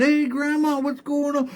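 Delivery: very high, drowsy tree voice; a just-woken tree greeting Grandma; high sleepy tree spirit. Acted. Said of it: "The tree woke up and said".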